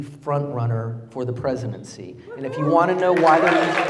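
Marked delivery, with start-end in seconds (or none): 2.6-3.9 half-shouted opening, much louder; loud rally call. Acted. Candidate defending Trump to the rally crowd, loud call. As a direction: throw the line out over the crowd. First take drowned out.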